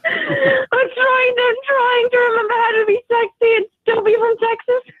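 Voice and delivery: high, squeaky voice fighting back real laughter; helpless laughter. Real, not acted. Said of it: "It's too funny now".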